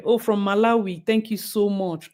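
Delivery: usual speaking voice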